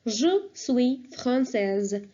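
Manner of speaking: "Je suis française" very slow and careful; slow model phrase. Shown as a demonstration. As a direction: stretch out "Je suis française" for learners. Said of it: "I will say".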